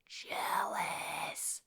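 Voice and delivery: drawn-out, voiceless whisper; dramatic whisper. Real, not acted. Storyteller revealing Deb's reaction to the sighting, long stage whisper. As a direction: whisper it slowly for effect.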